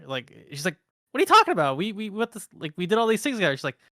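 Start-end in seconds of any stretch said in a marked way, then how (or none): 1.1-3.6 high, baffled and insistent; incredulous. Retold or imitated. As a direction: she can't believe you forgot her. Acted confused NPC, quoted.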